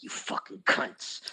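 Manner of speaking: slow, low whispered swearing; whispered insult. Retold or imitated. Retelling his retort to drunk friends, whispered insult. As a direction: curse them under your breath, slowly.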